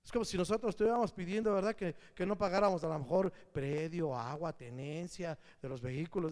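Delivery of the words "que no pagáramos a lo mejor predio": normal talking voice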